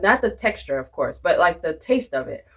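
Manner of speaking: usual speaking voice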